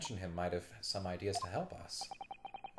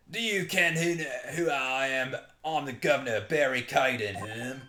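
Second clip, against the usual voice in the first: loud, hammed-up Scottish governor; Scottish accent; 4 semitones higher, 5 dB louder than usual. Prison governor introducing himself to visitors, Scottish accent. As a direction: announce yourself in broad mock Scots. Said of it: "he's very Scottish".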